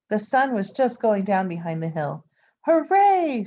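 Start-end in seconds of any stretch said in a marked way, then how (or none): 2.6-3.4 high, loud cheering Hooray; the townspeople cheering the finished cellar; crowd cheer. A narrator's reading. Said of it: "shouted the people".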